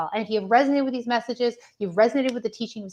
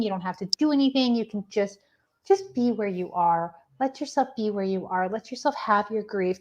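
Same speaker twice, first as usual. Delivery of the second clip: ordinary talk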